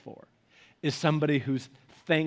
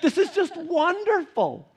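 much higher, slow, delighted wife voice; husband mimicking wife's glowing delight; imitating his wife; 10 semitones higher than usual. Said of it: "that's kind of like my voice for her".

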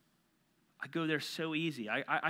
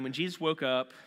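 ordinary talk

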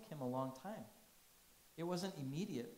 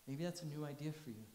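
normal talking voice

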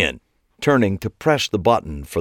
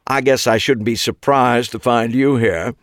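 put-on nasal British accent; 4 semitones higher, 3 dB louder than usual. A narrator's reading. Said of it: "nasally British accent".

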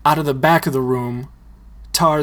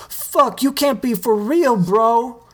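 disbelief; 10 semitones higher than usual. Acted. Tito disbelief.

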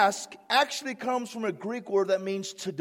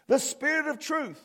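ordinary talk, nothing marked

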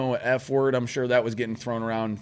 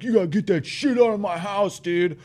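higher, louder mock outrage; raised-voice impersonation of indignant partygoer; imitated indignant objection; 9 semitones higher, 4 dB louder than usual. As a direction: mimic someone kicking a smoker out. Acting out indignant party host.

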